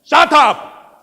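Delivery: high, explosive silencing yell; furious shout. Acted. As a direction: cut the begging off with a roar. Warlord silences the boy.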